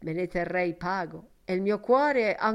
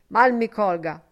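much louder cry of dismay; loud exclamation; 2 semitones higher, 10 dB louder than usual. A narrator's reading. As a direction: burst out as if slapping his forehead. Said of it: "esclamò egli".

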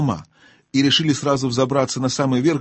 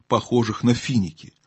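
ordinary delivery, nothing marked

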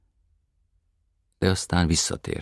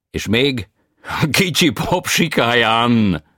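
foreign tenor voice; 9 semitones higher, 12 dB louder, much slower than usual. A narrator's reading. Tenor answers.

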